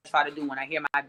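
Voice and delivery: ordinary talk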